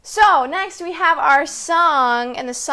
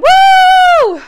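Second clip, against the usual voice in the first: cheer; 13 dB louder than usual. Real, not acted. End of song cheer.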